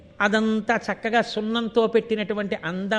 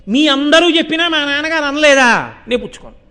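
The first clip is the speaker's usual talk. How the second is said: louder, higher, insistent; emphatic declaration; 5 semitones higher, 7 dB louder than usual. Retold or imitated. Rama refusing marriage without his father's word, loud and emphatic. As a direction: raise the voice to stand firm. Voicing Rama's firm refusal.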